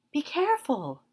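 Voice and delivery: put-on high girl's voice calling out a warning; cried out warning. A narrator's reading. Green girl warns Dorothy about her silk gown, high. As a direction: call out quickly to stop her. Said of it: "cried the green girl".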